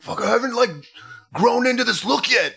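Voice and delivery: high, squeaky mock panic; panicked guy. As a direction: squeal it out like he's caught on camera. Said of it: "He's like".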